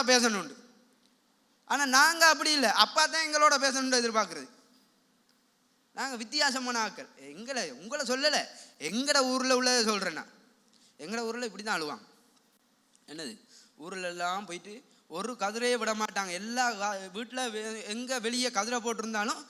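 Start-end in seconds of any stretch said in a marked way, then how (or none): none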